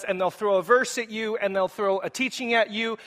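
usual speaking voice

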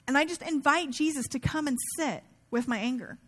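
ordinary talk, nothing marked

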